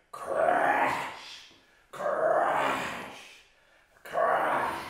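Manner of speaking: deep, loud booming crash noise for falling trees. Acted. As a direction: boom out each crash low and loud, like a tree hitting the ground. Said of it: "a terrible noise, like trees falling down".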